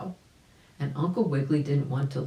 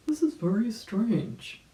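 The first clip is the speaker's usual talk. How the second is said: Uncle Wiggily; 7 semitones higher than usual. A narrator's reading. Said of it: "said Uncle Wiggily".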